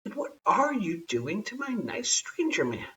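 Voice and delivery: put-on a little girl whispering in alarm; whispered child's protest. A narrator's reading. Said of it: "said Taffy".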